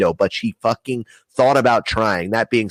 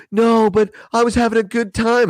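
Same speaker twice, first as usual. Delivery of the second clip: put-on much higher, louder whiny girl; mock girl whine; 3 dB louder than usual. Girlfriend protesting on the swing, high whiny mock. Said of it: "she's like".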